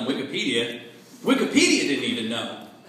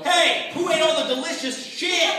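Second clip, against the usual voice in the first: fishing buddy; 13 semitones higher than usual. Retold or imitated. Fishing buddies returning from a smoke break, mock accusing. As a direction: call it out like a buddy back from break. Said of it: "They come back and go".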